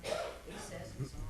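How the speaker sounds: faint off-mic voice; speaking away from the microphone; far from mic. Real, not acted. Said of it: "Up closer".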